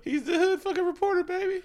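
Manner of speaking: very high put-on squeaky voice; cartoonishly high character voice.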